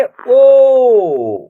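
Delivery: "ओ" as a long, full held vowel; pronunciation demo. Shown as a demonstration. Teacher showing how the letter is pronounced, "ओ" drawn out. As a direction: sustain "ओ", falling at the end. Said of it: "इसका उच्चारण करेंगे".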